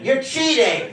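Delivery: ordinary talk, nothing marked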